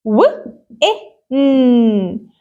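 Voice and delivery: "و-، إيه، ن" as separate w, short i, held n; sounding out. Shown as a demonstration. Teacher spells out win for pupils, sounding it out. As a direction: split "و-، إيه، ن" sound by sound, slowly. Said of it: "Let's try to read this word".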